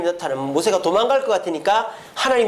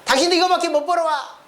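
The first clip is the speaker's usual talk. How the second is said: high, put-on angry retort; wife's retort; 10 semitones higher than usual. Wife answering husband about his earnings, angry retort. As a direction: fire back as the nagged wife. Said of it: "아내도 할 말 있잖아요".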